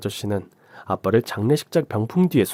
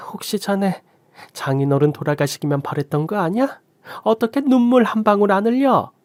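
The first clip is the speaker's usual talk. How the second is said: much higher, rising accusing outrage; indignant mother; 7 semitones higher than usual. Acted. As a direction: tear into the cold son-in-law. Mother berates the son-in-law.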